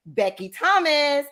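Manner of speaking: ordinary talk, nothing marked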